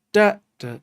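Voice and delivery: Da, da sung out high and punched loud; accented beats. Shown as a demonstration. Showing a viewer drum accents, loud punched syllables. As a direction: hit each Da, da hard like an accent. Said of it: "these are accents".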